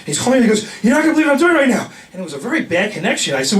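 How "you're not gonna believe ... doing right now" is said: excited rush. Retold or imitated. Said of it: "he's all out of breath".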